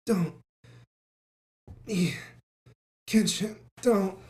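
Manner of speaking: wounded, halting gasps; acted gasping of an injured man. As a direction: struggle the words out between breaths. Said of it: "he's, like, gasping".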